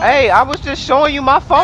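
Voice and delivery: high-pitched, indignant protest. Real, not acted. Protesting to game gang member.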